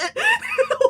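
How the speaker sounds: high-pitched burst of laughter; loud laugh. Real, not acted. Author cracks up at her own protest, loud laughter.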